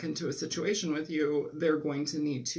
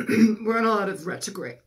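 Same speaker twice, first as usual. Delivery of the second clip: hoarse, straining through the words; scratchy, tired throat after coughing; losing voice; 3 semitones higher, 6 dB louder than usual. Real, not acted. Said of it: "I'm losing my voice".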